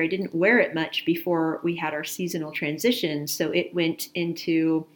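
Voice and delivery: normal talking voice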